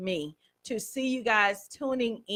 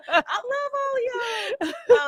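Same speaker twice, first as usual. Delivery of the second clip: crying with joy; 11 semitones higher, 6 dB louder than usual. Real, not acted. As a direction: let the tears break through the words. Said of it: "I think I got most of my tears out".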